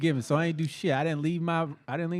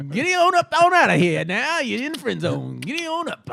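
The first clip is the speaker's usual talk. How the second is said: high, hollered mock send-off; horse-ride call; 8 semitones higher, 7 dB louder than usual. Joker shooing someone into friend zone, high mock holler. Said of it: "I call that the horse ride".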